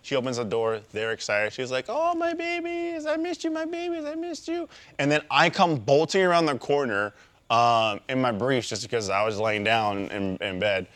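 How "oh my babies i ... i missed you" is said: cooing dog talk. Retold or imitated. Partner greeting the dogs at the door, cooing high. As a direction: imitate a doting pet owner in falsetto.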